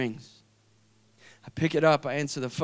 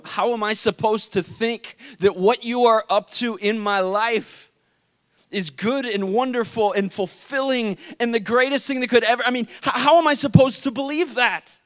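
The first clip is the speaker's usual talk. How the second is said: higher, mounting protest to God; anguished prayer; 5 semitones higher, 3 dB louder than usual. Retold or imitated. Man questioning God on the flight home, anguished. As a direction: build a pleading, incredulous challenge, retold with force.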